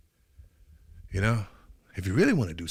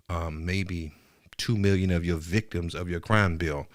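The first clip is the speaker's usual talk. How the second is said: ordinary talk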